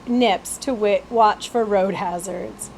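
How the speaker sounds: usual speaking voice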